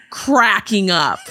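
"cracking up" slowly drawn out; meme emphasis. Shown as a demonstration. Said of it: "you have to say it like that".

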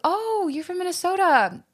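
high, bright-pitched Canadian accent imitation. Hockey player greeting a Minnesotan, in a Canadian accent. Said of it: "in a very Canadian accent".

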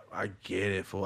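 ordinary talk, nothing marked

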